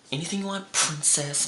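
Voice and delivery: high mocking sweetness to the captain; sarcastic high-pitched princess line; smart-ass remark. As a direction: tease her like royalty. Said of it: "in a smart-ass remark".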